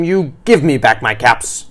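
loud storybook scolding. A narrator's reading. Peddler demanding his caps from monkeys, sternly loud. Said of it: "shaking a finger at them".

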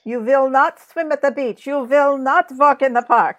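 stern foreign interrogator voice, laughing; cool foreign spy menace. Pretend. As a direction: bark orders like a movie villain. Mock authoritarian orders, laughing.

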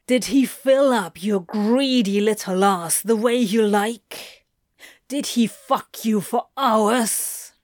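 hard, taunting interrogation; jealous Crowley, growling. Acted. Crowley interrogating lover about rival, jealous taunting. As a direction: press each question with angry force.

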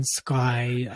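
usual speaking voice

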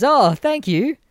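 overly cheerful thank you; hammed-up chirpy thanks; chirpy salesman thanks. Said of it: "And he goes".

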